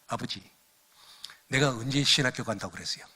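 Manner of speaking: ordinary talk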